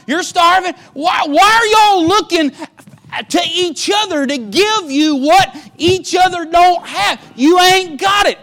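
high, loud scolding shout; scolding father. Acted. Jacob shouts down his starving sons for looking to each other. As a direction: berate the sons like an exasperated father. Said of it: "He says".

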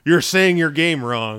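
loud, slow imitation of online complainers; mock scolding. Mimics online complainers scolding the company.

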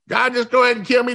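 high, fast, exasperated plea; complaining Moses. Retold or imitated. Preacher voicing Moses begging God to end it, exasperated. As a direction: Moses throws up his hands at God. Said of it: "He's complaining".

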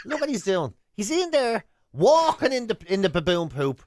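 ordinary delivery

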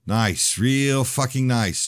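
slow, high sarcastic drawl. Acted. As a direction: sneer it out slowly. Jeremy sarcastic.

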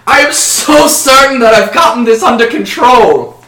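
high, loud, panicky elf plea; hammed-up desperate begging. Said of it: "I beg of you".

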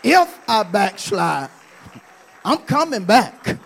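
ordinary delivery

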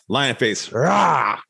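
playful mock lion roar; lion face roar. Host repeats the silly lion face warm-up roar. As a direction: open wide and roar the rah. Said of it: "my vocal warm-ups".

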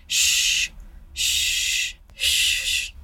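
long, hushed shushes; repeated drawn-out shh; shushing. Retold or imitated. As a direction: shush slowly into an empty room. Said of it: "she's, like, shushing".

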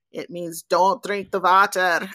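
imitated German guide, "water" as "vater"; German accent. Tour guide translating the sign for a tourist, German accent. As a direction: put on a light German accent. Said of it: "I asked the tour guide".

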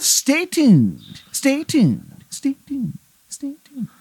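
high ghostly announcer fading to nothing; radio fading out. Acted. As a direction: let each stay tuned drift away like a dying signal. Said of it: "the song keeps playing".

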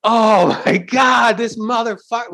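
collective amazement. Retold or imitated. Comic voicing the whole audience, a hint of awe. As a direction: draw it out like a whole room in awe. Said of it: "everybody collectively going".

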